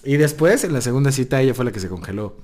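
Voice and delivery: ordinary talk, nothing marked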